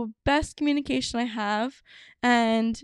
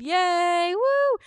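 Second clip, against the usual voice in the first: high, happy whoops; celebrating, jumping up in pitch; cheering; 6 semitones higher than usual. Real, not acted. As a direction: cheer like a finish line. Cheering the episode's end.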